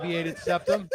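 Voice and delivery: ordinary talk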